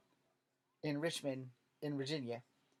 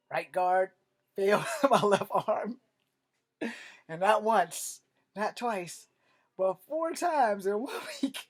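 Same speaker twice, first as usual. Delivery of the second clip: louder and higher, breaking into laughter; laughing; 5 semitones higher, 9 dB louder than usual. Real, not acted. Comic recalling his failed deodorant, laughing loudly. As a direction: crack up telling it.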